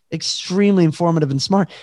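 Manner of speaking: ordinary talk, nothing marked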